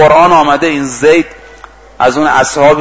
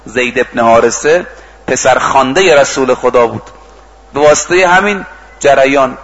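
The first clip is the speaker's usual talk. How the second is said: usual speaking voice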